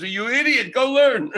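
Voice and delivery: high, loud scolding yell; mock scream. Stranger screaming at the young man on the bus. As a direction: bark it high at a foolish youth. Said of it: "he screams".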